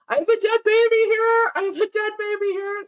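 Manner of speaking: high frantic cry from the dinghy; mock frantic yell. A stranded man calls up to the ship crew, high frantic cry. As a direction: call out desperately, pitched high. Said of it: "That's what he said".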